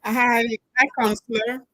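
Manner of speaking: ordinary talk, nothing marked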